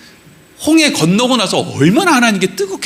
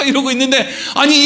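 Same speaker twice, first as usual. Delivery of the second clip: usual speaking voice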